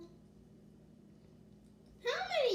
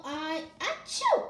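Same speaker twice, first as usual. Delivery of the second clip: sneeze; 5 dB louder than usual. Acted. Gerald sneezing in the story.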